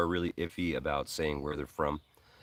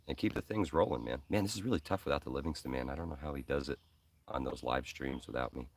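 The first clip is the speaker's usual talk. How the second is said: ordinary talk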